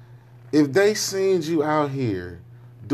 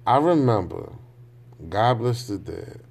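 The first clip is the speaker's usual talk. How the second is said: normal talking voice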